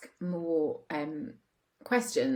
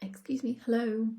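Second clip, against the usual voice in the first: hesitant, apologetic and higher; timid interrupting; 5 semitones higher than usual. Retold or imitated. Husband mimicking her timid interruption, meek and apologetic. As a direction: tiptoe in with a meek excuse me. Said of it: "It's like that".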